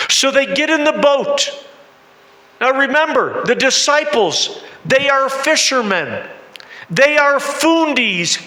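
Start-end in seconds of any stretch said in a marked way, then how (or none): none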